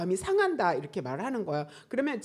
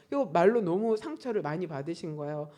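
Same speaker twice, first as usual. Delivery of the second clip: ordinary delivery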